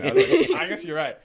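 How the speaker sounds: laughing. Retold or imitated. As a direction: concede while cracking up. Host laughs retelling defeat.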